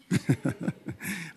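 a short teasing chuckle after the jab; teasing laugh. Real, not acted. Pastor teases a youth about a wrong answer, laughing. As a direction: laugh it off lightly. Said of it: "I'm joking".

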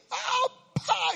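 high, loud cry with a popping smack; yelp, then voice the smack; imitated slap. Brother Bugs slapping a talking student, loud imitated smack.

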